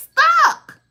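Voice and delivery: loud, high single-word punch; emphatic. Real, not acted. Telling her listener she's stuck, loud high punch. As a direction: slam the word down. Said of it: "emphatic punchline".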